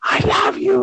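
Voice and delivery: gushing love. Retold or imitated. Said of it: "And I said".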